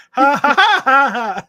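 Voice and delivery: high, loud staccato fake laugh; cartoon villain laugh. Claiming Nick wrote it that way, cartoon villain laugh. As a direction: belt out a cartoon arch-enemy's cackle. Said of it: "like the fucking dude from, uh, Dexter's Laboratory".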